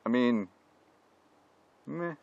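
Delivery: ordinary delivery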